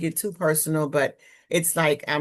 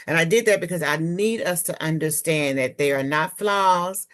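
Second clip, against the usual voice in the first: ordinary delivery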